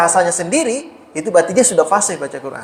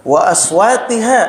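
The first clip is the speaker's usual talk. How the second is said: slow, raised chanting of the phrase; recited Arabic; 5 semitones higher, slower than usual. Shown as a demonstration. Teacher chants the Arabic phrase for the class. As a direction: lift and stretch it like recitation.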